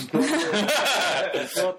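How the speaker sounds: high, bright burst of laughter; loud burst of laughter. Real, not acted.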